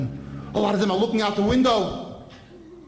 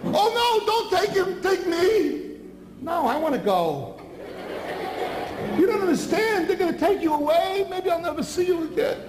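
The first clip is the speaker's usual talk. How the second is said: high, desperate pleading; pleading father; 12 semitones higher than usual. Acted. Father begging soldiers to take him instead, high and desperate. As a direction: beg them with everything you have.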